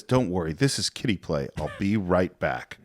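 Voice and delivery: a hint of Indy shrugging it off; lightly voiced hero, low and easy; Indiana Jones impression. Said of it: "and he says".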